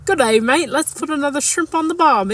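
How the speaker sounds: quick mock Australian accent. Playing an accents guessing game, Australian accent. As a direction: do a playful Aussie impression.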